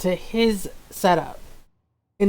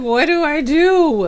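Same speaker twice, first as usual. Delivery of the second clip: higher-pitched, exasperated retold plea; mock panic; 6 semitones higher, 4 dB louder than usual. Streamer retelling the broken capture to viewers, mock panic. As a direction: replay your helpless panic, pitched up.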